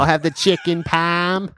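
high, slow mock Boston accent. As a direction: order like a hammed-up Boston local. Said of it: "We could be in the movie".

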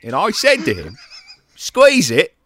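loud, high, laughing mock British voice; Michael Caine impression. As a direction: push the Caine voice to a laughing climax. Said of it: "Everyone do their best Michael Caine impression".

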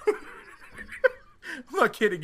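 slow, high laughing protest; drawn out through giggles. Real, not acted. Stunned by argument, laughing.

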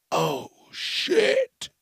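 squeaky high startled oh shit; high squeal. Real, not acted. Reacting to a moth's scary name, high squeal. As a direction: squeak it out in shock.

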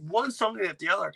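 ordinary delivery

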